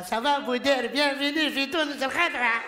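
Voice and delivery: lightly sung Tunisian lilt on the welcome; singsong Tunisian accent. Retold or imitated. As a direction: greet warmly with a hint of Tunisian singsong. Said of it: "avec cet accent un peu chantant". (léger accent tunisien chantant)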